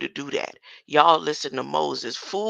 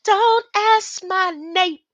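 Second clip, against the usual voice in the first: higher, louder, slow mocking voice; mock silly voice; 12 semitones higher, 8 dB louder, slower than usual. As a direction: stretch it out in a silly put-on voice. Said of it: "I thought they said".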